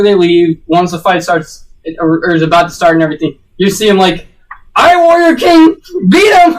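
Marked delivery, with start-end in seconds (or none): 4.7-6.5 high, drawn-out cheering cry; mock fan cheer. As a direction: yell it like an overexcited fan in the stands. Fans cheering on the Warrior King.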